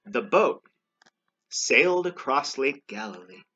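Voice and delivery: deliberate storybook narration, starting loud; read-aloud to children. A narrator's reading. Reader closing the story for children, storybook narration. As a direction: read out the ending in measured phrases.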